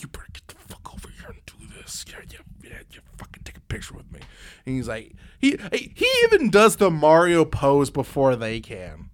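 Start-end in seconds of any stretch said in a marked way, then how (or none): 0.0-4.2 hushed urgent whisper; mock whisper to awkward mascots; whispered aside. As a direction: hiss it through a fake smile so only they hear.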